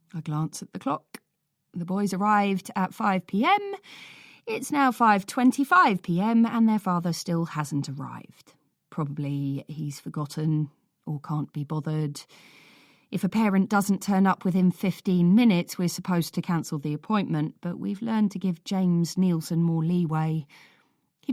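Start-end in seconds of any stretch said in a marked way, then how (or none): none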